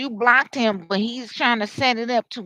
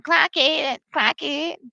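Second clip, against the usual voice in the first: higher, punchy sassy call; catchphrase imitation; 5 semitones higher, slower than usual. Mimicking what they do all the time, sassy. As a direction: mimic their sassy habit. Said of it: "they do all this all the time".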